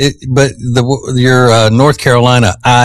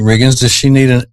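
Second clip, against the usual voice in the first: ordinary talk, nothing marked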